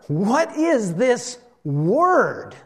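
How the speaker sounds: very slow, drawn-out wonder; amazed. Retold or imitated. Amazed crowd asking one another about Jesus, in wonder. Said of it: "they were all amazed".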